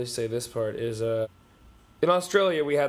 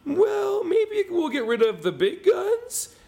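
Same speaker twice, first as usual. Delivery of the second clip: very high, slow, squeaky mock-timid question; mock timid government; 4 dB louder, slower than usual. Mocking the American government's timid answer, very high and slow. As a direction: whimper it like a spineless politician. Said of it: "and your government went".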